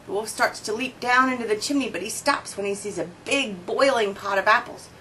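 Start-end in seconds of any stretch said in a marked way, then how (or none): none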